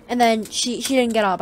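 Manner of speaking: ordinary talk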